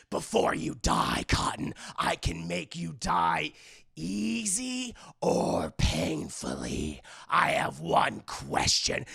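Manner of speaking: slow taunting slasher; Ghostface. Retold or imitated. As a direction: draw it out with slow menace. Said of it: "the Ghostface is like".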